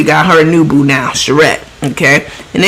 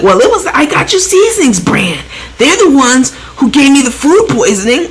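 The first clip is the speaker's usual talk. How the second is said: complaining customer; 7 semitones higher than usual. Retold or imitated. Mimics a sick customer complaining.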